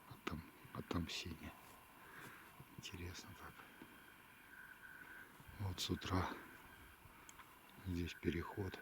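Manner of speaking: hushed whisper out in the field. Real, not acted. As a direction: keep it down so the game won't hear. Quiet talk while hunting on slope.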